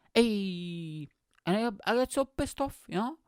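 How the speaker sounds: odd high voice. Real, not acted. Ranting over a bus not in service, high-pitched voice. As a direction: let the indignation ride high in pitch. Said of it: "I don't know why I'm talking like that".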